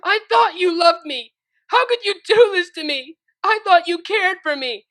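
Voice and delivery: high, loud tearful shouting; crying and shouting. Acted. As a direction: shout through tears at her betrayer. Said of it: "crying and shouting".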